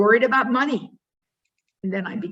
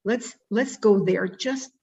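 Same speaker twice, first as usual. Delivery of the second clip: ordinary delivery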